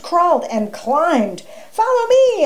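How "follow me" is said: high, loud call; crying out. A narrator's reading. Alfred leads Wolf up the tree, crying out. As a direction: call out high to someone following behind. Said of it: "Alfred cried".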